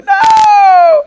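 very high, loud crying scream; child's shriek. Retold or imitated. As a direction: wail no as the thorn comes out. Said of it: "And you're like".

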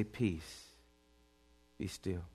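near-whispered, very soft command; hushed, tender command; hushed peace. Acted. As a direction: breathe it out gently like a calm voice. Jesus speaking softly.

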